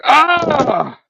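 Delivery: long, high "ahh"; startled cry. Retold or imitated. Spider-Man startled by another rat, high drawn-out cry. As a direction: cry out high in mock alarm.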